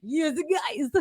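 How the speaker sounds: attempted Jersey accent. Shown as a demonstration. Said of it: "that was weird".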